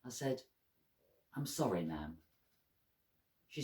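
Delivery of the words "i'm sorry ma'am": soft, low-pitched apology; low, hushed sorry; subdued apology. A narrator's reading. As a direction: apologise quietly and understatedly. Apologising to his crying mother.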